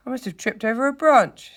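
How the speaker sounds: high, loud, sorry whine; miserable. A narrator's reading. Hurt mole explaining his fall to rat, miserably. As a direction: lament your accident in a high voice. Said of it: "said the mole miserably".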